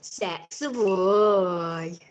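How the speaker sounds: slow, stretched pet-talk; drawn-out coo. Real, not acted. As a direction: croon it slowly to the dog.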